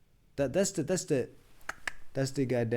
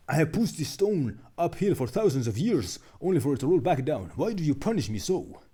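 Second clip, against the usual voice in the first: louder, higher, half-hearted strained hero complaint; mock Kratos voice; 4 semitones higher, 5 dB louder than usual. Streamer keeps reading Sisyphus's complaint, hammed-up Kratos voice. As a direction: grumble it up at the sky like a worn-out titan. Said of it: "I can't do a Kratos".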